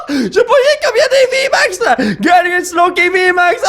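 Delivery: high, laughing squeal of disbelief; losing it over a dream pull; screaming with joy, voice cracking high; ecstatic shriek. Real, not acted. Pulls a huge hit card.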